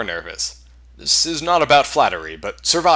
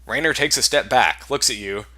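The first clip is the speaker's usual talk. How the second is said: ordinary talk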